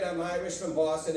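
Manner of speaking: normal talking voice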